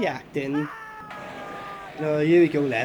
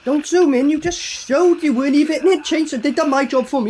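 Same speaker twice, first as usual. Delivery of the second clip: raised, louder and higher exclamation; high-pitched, loud complaint; loud protest; 6 semitones higher, 5 dB louder than usual. Real, not acted. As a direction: call out the blunder in loud disbelief. Protesting at the film's revealing zoom.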